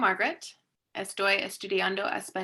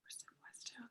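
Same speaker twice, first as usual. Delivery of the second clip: whisper; 27 dB quieter than usual. Real, not acted. Learner practising to herself.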